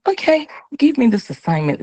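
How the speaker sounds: high, eager voice of the soul; soul answering. Acted. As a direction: play the soul, lifted and willing.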